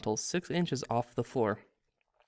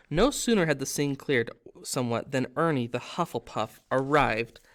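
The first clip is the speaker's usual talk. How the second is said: usual speaking voice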